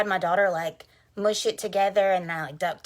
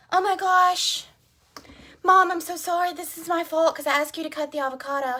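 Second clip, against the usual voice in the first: high, loud imitation of an upset kid; apologetic daughter; 10 semitones higher, 6 dB louder than usual. Mom imitating her daughter after the avocado cut, high and apologetic. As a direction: blurt a mock apology in a raised, pleading voice.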